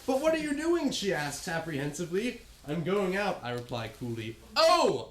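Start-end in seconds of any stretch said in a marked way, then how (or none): 4.5-5.1 high loud mock gasp; hammed-up startled exclamation; exclaiming. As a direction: blurt it out startled. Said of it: "I ejaculate".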